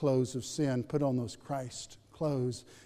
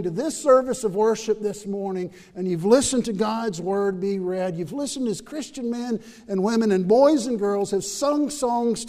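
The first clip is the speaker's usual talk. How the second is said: louder, higher pleading appeal; full-force preaching; 6 semitones higher, 7 dB louder than usual. Acted. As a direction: plead with the hurting in the room. Altar call appeal at full force.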